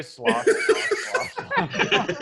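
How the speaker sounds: sloshing, rising with a laugh; sloshing sound effect. Pretend. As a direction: imitate liquid sloshing.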